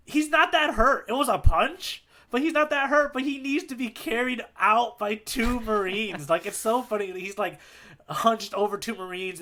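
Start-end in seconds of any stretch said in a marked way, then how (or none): 0.1-6.3 mocking disbelief. Real, not acted. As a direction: scoff at how little he's hurt. Mocking Helmeppo's fuss.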